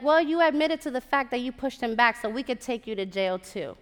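lightly voiced officer laying out the facts; cops' retort. Cops answering the woman who called them, mildly officious. Police officers' reply, acted.

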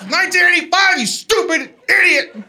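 imitating an outraged sister yelling; sister's yelled outburst. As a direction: yell it out like an uncontrollable heckle. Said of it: "she would yell out".

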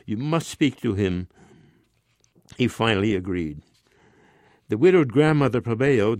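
plain reading voice

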